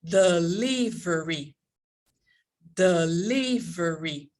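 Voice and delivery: slow "delivery" with a long, stressed LI; de-LIII-very, slow drill of "delivery"; word stress drill. Shown as a demonstration. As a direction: stretch the second syllable of "delivery". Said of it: "we are going to start with".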